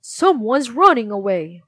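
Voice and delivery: loud calling shout; alarmed shout. Acted. Soldier spotting the runaway, loud alarmed shout. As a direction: raise the alarm, pointing him out. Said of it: "he shouted".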